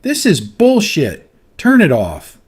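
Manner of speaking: loud, slow, furious outburst; booming shout. A narrator's reading. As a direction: boom it across the studio. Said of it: "His voice boomed through the studio".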